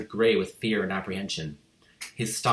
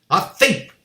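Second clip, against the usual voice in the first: a much higher, very loud scream; 10 semitones higher, 10 dB louder than usual. A narrator's reading. Headmistress accusing the boy, screaming. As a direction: shriek the accusation. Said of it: "the Trunchbull screamed".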